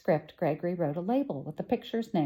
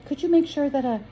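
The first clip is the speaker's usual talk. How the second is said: higher, louder anxious plea; 9 semitones higher, 7 dB louder than usual. Acted. Boy anxiously asking the shop woman, anxious and higher. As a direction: ask nervously, worried it comes out wrong. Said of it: "he said anxiously".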